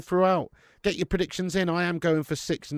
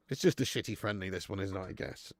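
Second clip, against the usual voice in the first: much lower, softer, fading shrug; quiet deflated grumble; resigned mutter; 7 semitones lower, 9 dB quieter than usual. Real, not acted. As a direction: let it trail off in resignation. Resigned repeat of his complaint.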